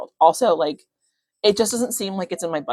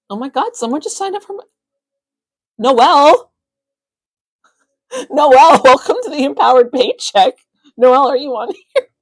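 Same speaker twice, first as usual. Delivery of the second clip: high, loud, thrilled squeal of welcome; real excitement; 8 semitones higher, 9 dB louder than usual. Real, not acted. Host greeting Noelle, who just signed up mid-live, thrilled. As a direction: burst out overjoyed, pitch shooting up. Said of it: "That's so exciting".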